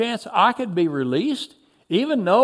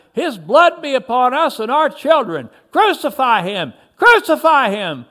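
high, loud, violent demand; shout; 5 semitones higher, 6 dB louder than usual. A narrator's reading. The crowd grows angrier at Pilate, shouting louder. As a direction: shout it with mounting anger. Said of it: "Another shouted".

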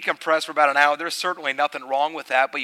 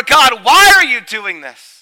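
loud, high and slow anguished plea; mock cry to God; 9 semitones higher, 14 dB louder, slower than usual. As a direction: shout up at heaven. Voiced complaint to God.

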